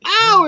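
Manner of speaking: high, loud mock ow; imitated yelp of pain; retold ow of pain. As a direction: cry out as if hit in the eye. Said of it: "I'm like this going".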